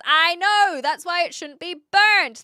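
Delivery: half-shouting back at chat; exasperated shout. Real, not acted. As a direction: shout it in disbelief.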